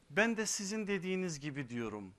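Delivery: high, loud, impassioned sermon voice; fiery preaching. Acted. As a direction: preach it out with rising force. Sermon rising to full force.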